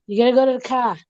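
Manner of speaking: Boston accent, "car" without the R; casual Boston accent on "car". Shown as a demonstration. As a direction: drop the R in "car", Boston style. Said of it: "catch the Boston accent in it once".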